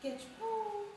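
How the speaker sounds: imitated mourning dove; dove's call. A narrator's reading. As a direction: coo it high like a dove, holding "pole". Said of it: "The dove said".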